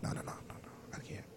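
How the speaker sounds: rapid nos dissolving into a whisper; hushed, fading string of refusals; overwhelmed refusal. Real, not acted. Host rejecting poetic crying, overwhelmed whispered refusal.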